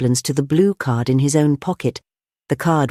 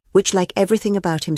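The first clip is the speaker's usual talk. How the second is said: ordinary delivery, nothing marked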